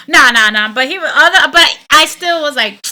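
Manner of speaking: normal talking voice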